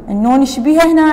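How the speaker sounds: ordinary talk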